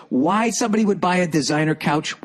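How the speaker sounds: normal talking voice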